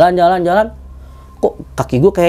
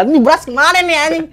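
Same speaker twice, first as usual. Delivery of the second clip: high, mock-scolding mother; 10 semitones higher than usual. Mom scolding her son about the missing rice, high mock nag. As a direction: play his mom fussing at him over the rice.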